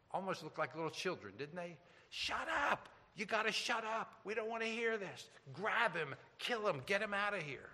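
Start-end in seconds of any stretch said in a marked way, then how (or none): 2.1-7.7 high, hushed mock scream; mock angry scream. Accusers screaming at Stephen to shut him up, high mock yell. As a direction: imitate an angry mob screaming, without real volume. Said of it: "They were screaming".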